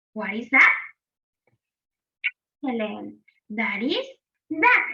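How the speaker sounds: ordinary talk, nothing marked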